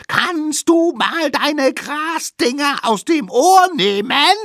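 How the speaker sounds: very high, slow shouted question; extra-loud calling. A narrator's reading. Little savages shouting at mammoth with grass in ears, extra-loud yell. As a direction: yell word by word at plugged ears. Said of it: "riefen sie extra laut".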